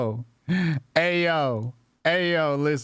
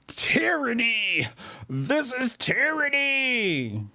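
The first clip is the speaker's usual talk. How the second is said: slow, higher mock-dramatic echo; 5 semitones higher, slower than usual. Mocking the streamer's buzzword back at him. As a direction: parody the grifter's big word slowly.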